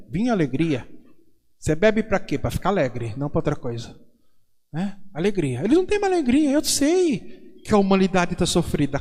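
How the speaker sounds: ordinary delivery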